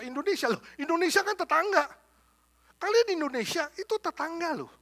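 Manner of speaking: high, loud mock scolding policeman voice; exasperated policeman telling off two quarreling church groups; Filipino policeman scolding.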